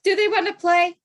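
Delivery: high, chirpy, oblivious question; dog owner walking up to a working guide dog, chirpy; mock eager dog owner. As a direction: play the clueless owner cheerfully.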